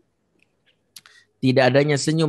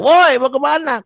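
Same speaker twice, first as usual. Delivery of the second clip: shouting from afar, high and loud; mock yell to someone far away; 14 semitones higher, 6 dB louder than usual. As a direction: call across a distance. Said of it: "udah teriak".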